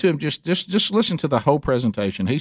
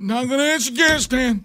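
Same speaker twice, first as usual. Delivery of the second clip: put-on mumbled old politician voice, higher; Biden impression; 8 semitones higher than usual. Repeating Biden's dodge for laughs, mumbled. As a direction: brush it off like a frail old man. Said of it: "a new language, Bidenese".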